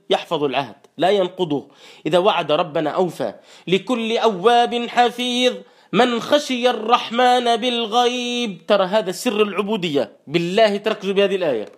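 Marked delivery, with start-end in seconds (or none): 3.7-8.7 slow melodic tajweed chant; Quran recitation. Acted. Preacher chanting the verse to the congregation, slow and melodic. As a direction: draw out each verse like a reciter.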